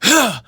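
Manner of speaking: short, loud, high "huh"; scream attack. Shown as a demonstration. Coach showing how to execute it, sharp screamed burst. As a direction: punch a burst of air from the diaphragm. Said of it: "That's how you ex".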